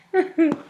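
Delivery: high burst of laughter. Real, not acted. Reader laughs out loud at herself. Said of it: "This is so stupid".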